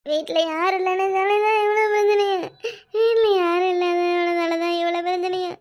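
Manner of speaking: tearful telling in a droning wail; funeral lament. Pretend. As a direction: sob out the story, stretching each phrase. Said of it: "இப்படி ஒப்பர் வைக்கிறது". (அழுதுகொண்டே ஒப்பாரி புலம்பல்)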